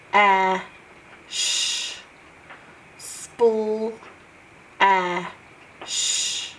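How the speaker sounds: "ah" and a hissed "sh" said as separate sounds; sounding out. Shown as a demonstration. Teacher sounding out splash for pupils, one sound at a time. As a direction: isolate each sound with pauses between. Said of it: "makes the sound sh".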